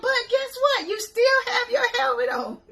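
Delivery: very high, loud, laughing call; laughing shout. Retold or imitated. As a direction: tease her while cracking up. Teasing call after her, laughing.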